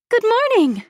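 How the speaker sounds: very high, loud, happy chirp; chirpy. A narrator's reading. Assistant arrives at the gallery in the morning, chirping happily. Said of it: "she chirps, as happy as a bird".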